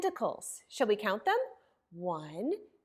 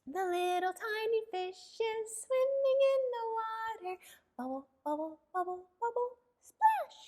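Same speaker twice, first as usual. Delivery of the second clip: higher sung voice for a tiny fish; little tiny fish voice; 9 semitones higher than usual. Pretend. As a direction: sing it high and small like a tiny fish. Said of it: "Can we do a little tiny fish?".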